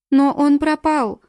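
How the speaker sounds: loud, high, slow cry of loss; exclaiming. A narrator's reading. Nina cries out to the detective, high and loud. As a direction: wail that the boy is gone. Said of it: "воскликнула она".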